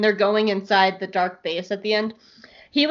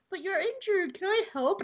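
higher, much softer caring voice; gentle concern; 10 semitones higher, 8 dB quieter than usual. Retold or imitated. Retelling, he worries over her ankle, soft and high. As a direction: coo it like a golden retriever. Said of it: "He's like".

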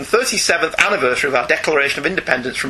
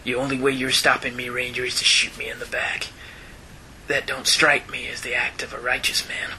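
Clint Eastwood; 3 semitones lower, 7 dB quieter than usual. Pretend. Host reads the old gunfighter's speech in a Western voice. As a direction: play a tired old gunfighter, hushed and low. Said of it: "I've gotta do my best Clint Eastwood".

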